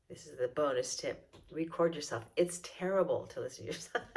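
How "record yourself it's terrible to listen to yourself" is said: normal talking voice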